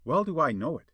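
usual speaking voice

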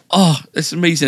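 high-pitched mimicry of a thrilled wife; delighted thanks. Wife thanking husband after a hug, delighted.

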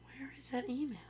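whispered to herself; whispering. Real, not acted. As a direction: whisper while searching. Searching her inbox, muttering.

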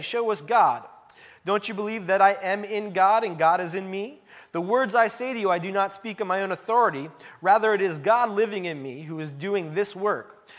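preacher reading Jesus' words from the pulpit; scripture reading. Acted. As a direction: read the Gospel out to the congregation. Reads scripture passage aloud.

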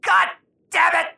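loud frustrated curse; angry outburst. Acted. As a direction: blurt the curse loudly. Outburst at missing potatoes.

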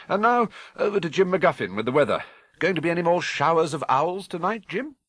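jaunty TV anchor handing over; cheery newsreader. Acted. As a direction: toss it brightly to the weatherman. Newsreader hands over to weatherman.

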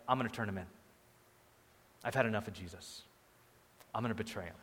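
low, soft, fed-up resolve; quietly decide to betray, voice dropped; imitated snapping. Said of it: "he snapped and was like".

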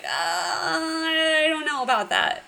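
nervous hesitation. Retold or imitated. Nervous guy quoted in recap.

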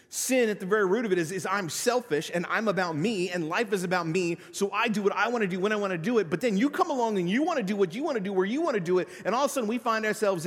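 quick, loud, driving preaching; fast preaching. Acted. Pastor preaching fast to his congregation about selfishness. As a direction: rush it out like a sermon climax.

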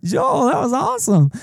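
high, amazed mock gasp of delight; impressed. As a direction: sound blown away by a line you just heard. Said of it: "I was like".

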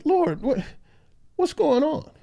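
high-pitched mock bewilderment; dismayed bewilderment. Preacher acting Ezekiel questioning God, high-pitched dismay. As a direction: plead upward, baffled, as if questioning God.

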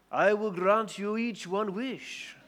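put-on slow genie with an Irish-Indian accent. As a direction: grant the wish in a mixed Irish-Indian accent, slowly. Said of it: "'cause he was sort of Irish and sort of Indian".

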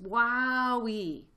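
loud, high, stretched amazement; gasped. A narrator's reading. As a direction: whoop it long after a fiery gulp. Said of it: "he gasped".

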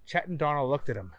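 plain reading voice

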